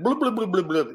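blubbing underwater bubbles; rapid burbled blubs; bubble noise. Acted. Storyteller sinking his hero into the ocean, bubble noise. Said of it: "That's my bubble noise".

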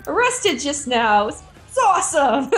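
high, gleeful imitation ending in a laugh; Ted, elated. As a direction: quote a thrilled interviewee, laughter creeping in. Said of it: "he's like".

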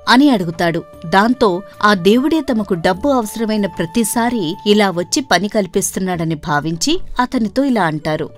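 ordinary delivery, nothing marked

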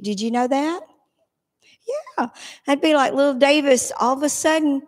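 ordinary talk, nothing marked

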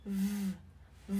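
voiced V held as a long buzz; V rung cổ. Shown as a demonstration. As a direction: hum the V sound with the throat vibrating. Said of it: "chúng ta sẽ rung cổ nhá". (âm V rung dây thanh, kéo dài)

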